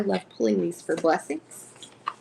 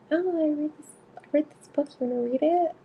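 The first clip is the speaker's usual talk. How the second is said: raised, eager mocking voice, showing off a book; mock eager guy; 8 semitones higher, faster than usual. Retelling a chat with a guy, mocking him, pitched up and eager. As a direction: parody him bragging about his reading, pitched up.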